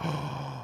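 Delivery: soft, drawn-in gasp of pretend shock; feigned horrified intake of breath; mock-offended gasp. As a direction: gasp as if scandalised.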